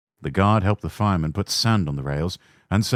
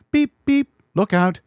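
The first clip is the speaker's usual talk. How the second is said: high whistling "beep, beep", loud and higher; engine whistle; 14 semitones higher, 5 dB louder than usual. A narrator's reading. Little engine whistling a warning at the station, high and loud. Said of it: "We whistle before every station".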